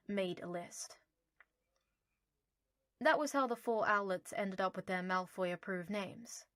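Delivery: ordinary delivery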